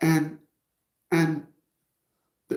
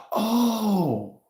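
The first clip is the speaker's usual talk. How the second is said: distressed woman's gasp; 6 semitones higher than usual. Retold or imitated. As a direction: gasp in dismay. Girl on phone voiced.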